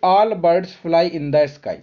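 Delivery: the sentence said slowly, word by word; slow demo sentence. Shown as a demonstration. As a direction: lay out the sentence slowly for students. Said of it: "इसको आपको interrogative बताना है". (धीमे-धीमे उदाहरण वाक्य)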